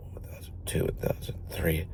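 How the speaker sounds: whispering the seconds under his breath; whispered count. Retold or imitated. Timing the light while retelling it, whispered count.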